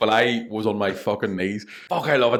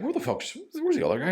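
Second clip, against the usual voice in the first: a much higher voice asking where he is; impatient mates. Acted. Friends at the pub wondering where he is, pitched high. Friends waiting for third guy.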